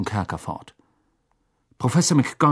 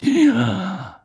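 slow, drawn-out musing character voice; half-interested, dragging the words out; unmoved; 12 semitones higher, 4 dB louder than usual. A narrator's reading. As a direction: mull it over without concern. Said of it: "kratzte sich ungerührt am Kinn".

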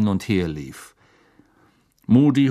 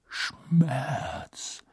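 soft, drawn-out single word; quiet; 2 semitones higher, 8 dB quieter than usual. A narrator's reading. Teacher naming the curse's effect, very quiet. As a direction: breathe it out softly and slowly. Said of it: "sagte Moody leise".

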